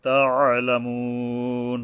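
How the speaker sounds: chanted তাআলামুন, "moo" held three beats to a stop; three-alif stretch. Shown as a demonstration. Teacher shows how to stop, তাআলামুন slowly drawn out. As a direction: hold the end of তাআলামুন very long. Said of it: "তিন আলিফ টেনে থামতে হবে".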